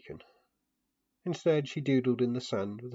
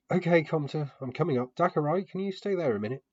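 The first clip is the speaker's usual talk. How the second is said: louder, higher call while climbing; 8 semitones higher, 8 dB louder than usual. A narrator's reading. Narrator calls to both children while climbing the statue, louder and higher. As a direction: call up and over, slightly strained.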